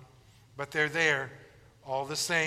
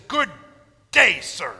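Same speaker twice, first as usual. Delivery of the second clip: loud, snapped good day; Wonka's loud, curt brush-off; curt dismissal; 6 dB louder than usual. Retold or imitated. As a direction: cut him off with it. Wonka dismisses them again.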